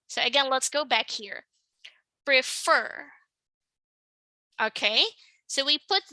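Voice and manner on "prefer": "Prefer" stressed on its second syllable; "Prefer" as pre-FER, second syllable prominent. Shown as a demonstration. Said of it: "The stress is on the second syllable".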